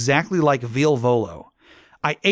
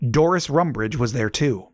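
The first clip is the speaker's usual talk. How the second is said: ordinary delivery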